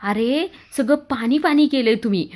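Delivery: put-on mother scolding the soaked kids. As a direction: tell them off for dripping everywhere.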